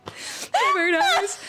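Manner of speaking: very high, drawn-out whimper; hammed-up weepy wail with a laugh; mock crying. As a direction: squeak it out as fake sobbing. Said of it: "You say crying".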